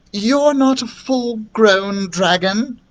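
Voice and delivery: high, slow questioning; incredulous princess. A narrator's reading. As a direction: stretch it out in disbelief. Princess surprised by the news.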